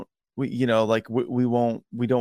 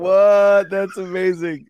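amazed; 8 semitones higher, 6 dB louder, slower than usual. Real, not acted. Amazed reaction to truck story.

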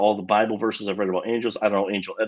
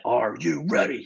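slow, higher-pitched, intense impression; Macho Man Randy; 5 semitones higher than usual. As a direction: imitate an intense wrestler drawing out the words. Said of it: "Sound like Macho Man Randy".

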